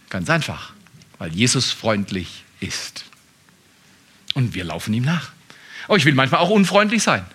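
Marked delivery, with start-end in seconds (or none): 0.1-5.3 leise und tief. Real, not acted. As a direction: sag die Antwort ganz leise. Leise Auflösung nach Flüsterfrage. (leise, tiefe Antwort)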